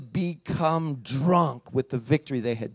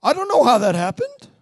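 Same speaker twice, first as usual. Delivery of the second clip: higher, baffled church voice; mock bewilderment; 6 semitones higher, 3 dB louder than usual. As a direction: act caught off guard by a healing. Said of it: "we're like".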